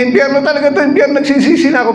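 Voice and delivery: high, fast frantic rant; raving. Acted. A damned man raving over his ugly bride, high frantic rant. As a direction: rave that you regret it all, high and fast. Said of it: "Nagwawala siya".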